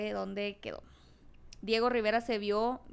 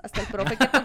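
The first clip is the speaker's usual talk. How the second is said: stifled giggles; 7 dB louder than usual. Real, not acted. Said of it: "nos estábamos aguantando la risa".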